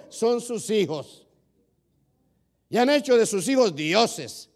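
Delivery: loud, driving sermon to the congregation; fiery preaching. Acted. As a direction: preach it out at full force. (predicando a todo pulmón)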